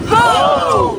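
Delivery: shouted work call. Real, not acted. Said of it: "you're gonna say ho".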